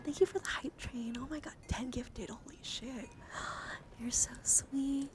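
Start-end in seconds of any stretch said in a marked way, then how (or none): none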